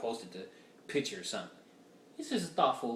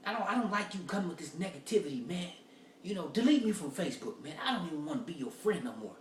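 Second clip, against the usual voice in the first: higher mock-offended rant; offended guy; 6 semitones higher than usual. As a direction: whine about the negativity like the offended guy. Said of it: "He's like".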